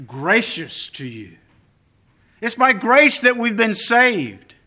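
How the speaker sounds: preacher declaring grace to the church; full-force preaching. Acted. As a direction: drive the point home to the congregation.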